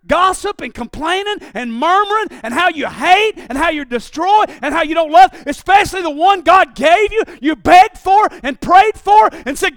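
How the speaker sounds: loud, rising preacher's cry, lines hammered out; full-force preaching. Real, not acted. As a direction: preach it hard to the congregation.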